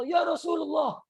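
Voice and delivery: high, slowed, panicked calling out; alarmed plea. Retold or imitated. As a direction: run crying to the Prophet in alarm. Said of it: "dia lari kepada Rasulullah".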